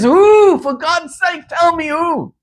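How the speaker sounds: desperate demand. Retold or imitated. King desperately demands a name.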